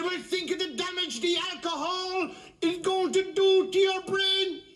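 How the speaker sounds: very high-pitched scolding nun; drawn-out, high moralising warning; preaching nun. Acted. A nun warning a man outside a bar, high-pitched preaching.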